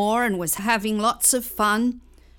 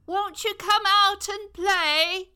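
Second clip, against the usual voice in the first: put-on high child calling out; 10 semitones higher than usual. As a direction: invite them out sweetly. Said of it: "She called to the grown-ups".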